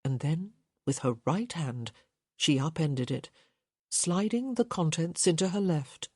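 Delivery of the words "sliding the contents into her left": usual speaking voice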